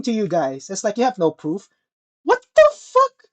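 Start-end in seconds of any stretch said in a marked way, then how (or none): 2.2-3.2 much higher, slow drawn-out what the fuck; venting at friends who won't believe him; exasperated outburst. Real, not acted. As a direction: throw your hands up in disbelief.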